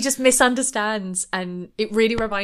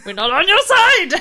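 high, loud shouted correction; hammed-up yell, pitched way up; mock outraged yell; 11 semitones higher, 9 dB louder than usual. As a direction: shout it through amusement, imitating exasperation. Acts out the group's outburst.

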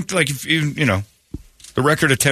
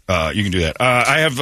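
usual speaking voice

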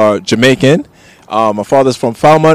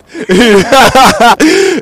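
amused laugh; 9 semitones higher than usual. Real, not acted. Laughs after patois demo.

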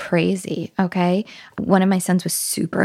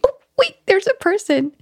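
much higher, playful gasp of discovery; mock surprise; 13 semitones higher than usual. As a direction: act amazed for the children. Acting out spotting someone for kids.

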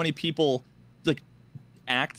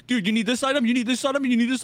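high, insistent mock offers; imitated eager helper; over-helpful player impersonation; 9 semitones higher, faster than usual. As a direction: pile on eager offers, pitched way up.

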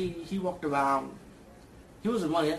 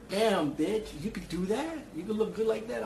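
higher-pitched playful disbelief; mock amazement; 6 semitones higher than usual. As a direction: play it amazed and impressed, pitched up. Imagined reaction to witch's makeover.

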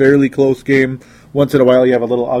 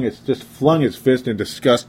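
normal talking voice

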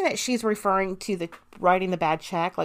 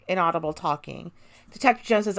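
normal talking voice

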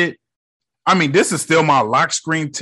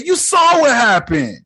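exasperated rant; 8 semitones higher than usual. Real, not acted. Ranting at Spider-Man.

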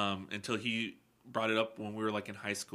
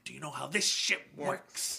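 movie villain imitation; 4 semitones higher than usual. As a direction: lean on each word like a menacing captain. Repeats the line, more in character.

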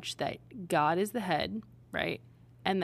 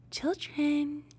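higher, sugary mom voice; Michelle's little voice; 8 semitones higher than usual. Retold or imitated. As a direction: imitate a sweet, creepy mother. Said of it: "her little voice is a lot".

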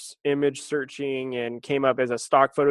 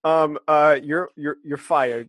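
awkward, hesitant. Retold or imitated. Translator awkwardly passing on a firing, hesitant. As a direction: stumble reluctantly through bad news.

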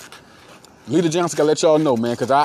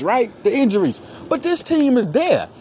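ordinary talk

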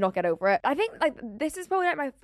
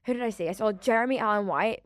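ordinary talk

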